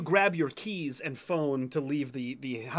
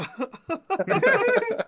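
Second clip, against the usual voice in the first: big burst of laughter; loud high laugh; 6 dB louder than usual. Real, not acted. Laughing at player.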